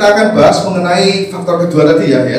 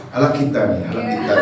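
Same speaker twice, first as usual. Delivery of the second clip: much lower, softer mock Batak greeting; Batak accent; 10 semitones lower, 8 dB quieter, faster than usual. Preacher imitates Batak people greeting each other, low and soft. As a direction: say it low and hushed like a Batak elder. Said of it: "orang Batak kalau ketemu ... ngomongnya".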